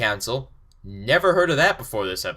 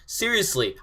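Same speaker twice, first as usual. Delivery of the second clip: ordinary talk, nothing marked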